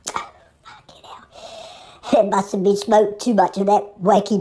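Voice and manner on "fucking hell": slow, breathy whispered swear; whispered curse. Acted. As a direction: mutter it under your breath, dragged out.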